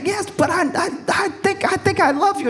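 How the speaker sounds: high, halting mock plea; pleading confession. Man pleading love to his reluctant date, acted halting plea. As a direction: stammer it out in a squeaky voice.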